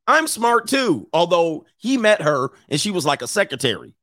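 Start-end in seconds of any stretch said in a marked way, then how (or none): 0.1-1.1 drawn out, much higher pouty voice; mock whiny Melinda. Wife sulking at husband over the letter, slow high pout.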